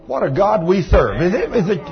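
ordinary talk, nothing marked

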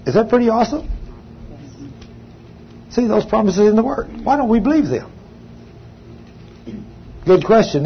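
usual speaking voice

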